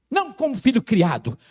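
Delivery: ordinary delivery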